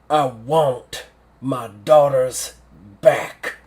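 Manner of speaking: slow, loud, weighted words from a general; grim demand. Acted. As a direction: drive it home word by word, slowly and forcefully. General's dramatic closing demand.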